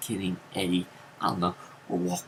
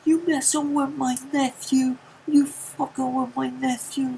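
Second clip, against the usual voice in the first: much higher, louder angry yelling uncle; furious uncle; 5 dB louder than usual. Retold or imitated. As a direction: storm in and confront the kids. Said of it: "he's like".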